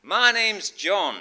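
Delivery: slow, loud, high Canadian voice; hammed-up Canadian accent, pitched right up. Canadian caller introduces himself to sleepy preacher, put-on Canadian accent. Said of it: "Excuse the accent".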